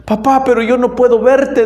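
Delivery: lightly voiced boy calling to his father he cannot see; child's voice. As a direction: play the scared little boy. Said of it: "dijo el niño".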